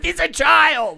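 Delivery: high, indignant protest; outraged outburst. Real, not acted. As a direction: burst out in disbelief at the injustice. Outraged defence of child actor.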